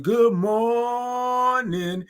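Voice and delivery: "morning" held very long, high; drawn-out good morning. Real, not acted. Host greeting all the viewers, "morning" drawn way out. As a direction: stretch "mor" into a long high note, then land "ning". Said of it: "all those O's and R's and I N G's".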